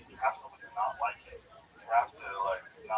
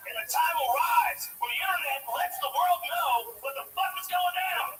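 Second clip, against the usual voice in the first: higher, louder paranoid ranting, like a street-corner prophet; conspiracy rant; 12 semitones higher, 4 dB louder than usual. Acted. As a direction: preach the cover-up as if waking the nation. Ranting conspiracy theorist bit.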